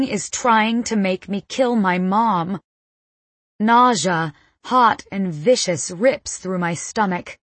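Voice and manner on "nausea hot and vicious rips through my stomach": usual speaking voice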